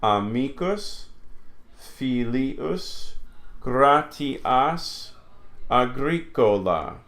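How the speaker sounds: slow "Amicus, filius, gratias, agricola", syllable by syllable; measured word list "Amicus, filius, gratias, agricola"; syllable division. Shown as a demonstration. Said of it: "a single consonant between two vowels goes with the second vowel".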